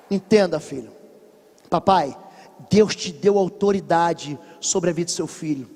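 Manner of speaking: ordinary talk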